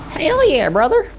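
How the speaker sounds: high, hammed-up American Southern holler; American Southern accent. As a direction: play an excitable backwoods American Southerner. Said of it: "Speak in an American Southern accent".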